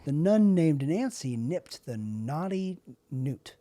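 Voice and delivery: slow, careful tongue twister; over-articulate every word slowly. Shown as a demonstration. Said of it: "Which tongue twister do you do".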